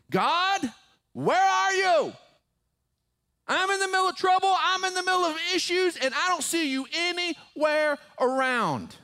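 loud, high-pitched anguished shouting; desperate cry to God. Acted. Preacher acting out David crying to God in trouble, desperate shout.

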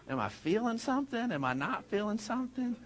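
softly wondering aloud, a hint of unease; hushed self-questioning. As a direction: murmur the doubt to yourself. Sister doubting alone in room.

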